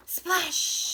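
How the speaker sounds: loud, high story splash; excited splash. Acted. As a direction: burst out with the big splash. Story climax, pig jumps in.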